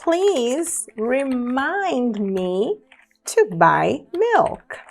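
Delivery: very slow, stretched "Please remind me to buy milk"; frase de exemplo muito lenta; slow example sentence. Shown as a demonstration. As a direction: draw out "Please remind me" for students. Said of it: "Olha só agora essa outra frase".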